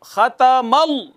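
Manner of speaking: ख़तमल slow, with the ल hit hard; struck shadda. Shown as a demonstration. Teacher demonstrating the struck shadda. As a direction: strike the ल firmly. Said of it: "पहले ज़ोर से मारा".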